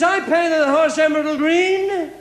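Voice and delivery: a hammed-up giant daring him to act; menacing giant. Giant challenges the milkman.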